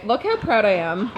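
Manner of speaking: ordinary delivery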